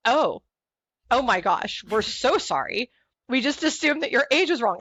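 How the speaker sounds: high and loud, gushing apology; mock apologetic staff. Imitating the clerks who got her age wrong, hammed-up apology. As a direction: ham up their flustered sorry. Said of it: "They go".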